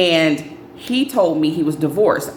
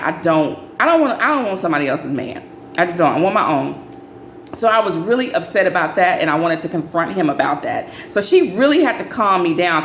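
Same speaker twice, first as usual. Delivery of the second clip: usual speaking voice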